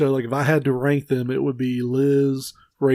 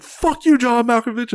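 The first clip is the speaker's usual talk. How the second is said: drive-by yell; 9 semitones higher than usual. Retold or imitated. Passenger hanging out the window yelling at John Malkovich, much higher shout. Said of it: "she's like".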